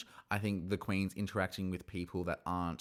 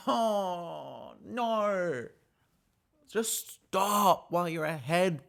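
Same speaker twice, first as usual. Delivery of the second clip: high, slow dismay at the contestant; mock despair talking to Bonnie; exasperated warning; 8 semitones higher, much slower than usual. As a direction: beg her to stop in a raised pitying voice. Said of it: "And I'm like".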